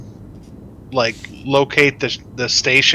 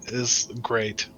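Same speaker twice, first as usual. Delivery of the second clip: ordinary talk, nothing marked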